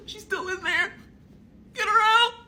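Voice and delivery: high-pitched squeal building to a shout; mock desperate plea. Can't name the friend still inside, mock desperate plea.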